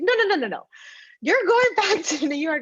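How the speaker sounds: high, loud mock protest; alarmed uncle. Uncle protesting her moving out, loud and high.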